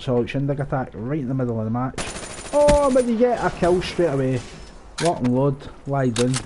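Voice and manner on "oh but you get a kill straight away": much higher, louder burst of glee; excited yelp. Real, not acted. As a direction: yelp it out, thrilled by the kill. Sudden kill while gaming.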